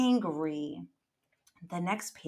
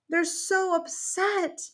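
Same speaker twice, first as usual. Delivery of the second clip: ordinary delivery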